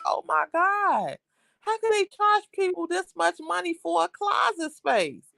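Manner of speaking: high-pitched, incredulous mock outcry; acted-out disbelief. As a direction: act appalled at the price, voice held high. Acts out disbelief at apartment prices.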